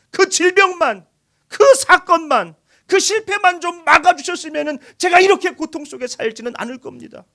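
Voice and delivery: loud, high cry of hurt; a believer's raw cry to God, loud and high; anguished plea. Acted. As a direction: pour out the complaint to God. Voicing believers' complaint to God.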